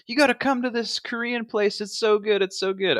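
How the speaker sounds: high, eager nudging; hint of pushy grandparents; imitating grandparents. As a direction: lightly mimic the excited grandparents. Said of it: "and they're like".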